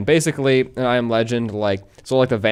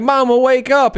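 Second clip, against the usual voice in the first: put-on pleading child; 10 semitones higher, 3 dB louder than usual. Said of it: "he's like".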